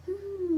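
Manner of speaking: high-pitched closed-mouth giggle; put-on "hmm" laugh; mock giggle. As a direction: imitate a silly schoolgirl titter, way up high. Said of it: "like, all laughing".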